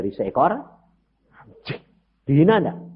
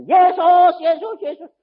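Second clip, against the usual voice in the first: calling out; 11 dB louder, slower than usual. Acted. Woman calling out again.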